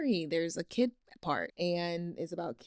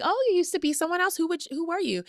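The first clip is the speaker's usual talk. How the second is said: surprised mom; 11 semitones higher, faster than usual. Retold or imitated. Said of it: "the mom was like".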